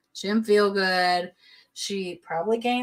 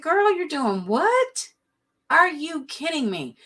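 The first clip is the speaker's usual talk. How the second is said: higher, slow, incredulous; shocked disbelief; 8 semitones higher than usual. Retold or imitated. Telling the pregnant woman she quits, shocked. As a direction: act floored by the news. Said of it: "I was shocked".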